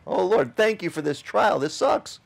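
sarcastic thank-you that cracks into a high whiny complaint; mock grumbling prayer. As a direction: pray in thanks, then whine about it. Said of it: "We don't do that well".

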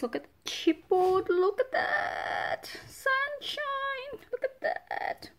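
high, breathy, whispered squeals of delight; excited squeal. Real, not acted. Crafter unboxing new chipboard, squealing with whispered delight. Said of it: "the reason why I'm getting so excited".

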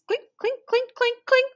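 high, even little clinks; imitated high-pitched metallic punching; ticket punch clinks. A narrator's reading. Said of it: "The conductor punched tickets".

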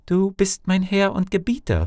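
high-pitched voice of the obedient wife; obedient Canetella. A narrator's reading. Canetella answers her husband's command, high obedient voice. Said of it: "versetzte Canetella".

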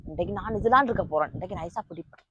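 muttering a plan to catch the friend; scheming under breath. Acted. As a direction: hush it like a secret plan. Plotting quietly to catch him.

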